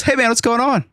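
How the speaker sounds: high, breezy seller voice; imitating the seller. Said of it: "That's how he talks".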